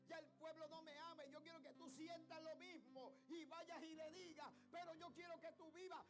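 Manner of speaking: builds from emphatic to high, loud shouting; rising pulpit fervor, slow and forceful; impassioned preaching. Real, not acted. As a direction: stretch the key words as the sermon climbs.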